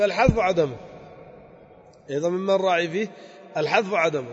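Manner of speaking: ordinary talk, nothing marked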